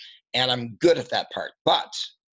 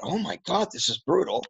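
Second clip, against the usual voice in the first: shocked thought; 7 semitones higher than usual. Retold or imitated. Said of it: "and I thought".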